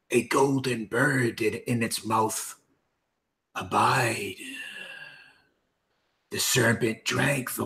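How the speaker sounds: slow, hoarse whispered menace; Wraith voice. Acted. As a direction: breathe it out like a wraith. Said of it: "in the Wraith voice".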